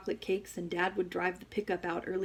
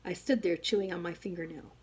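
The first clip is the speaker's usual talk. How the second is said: usual speaking voice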